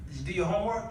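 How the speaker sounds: ordinary delivery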